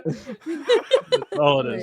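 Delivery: high, very slow mock French; laughing mock French accent. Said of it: "none of us can do a French accent".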